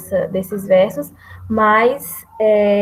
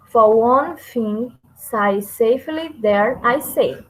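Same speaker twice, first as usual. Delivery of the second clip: slow, deliberate syllable-by-syllable reading; slow metrical demonstration; slow scansion reading; slower than usual. Shown as a demonstration. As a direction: read the meter out slowly. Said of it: "fiz essa escansão".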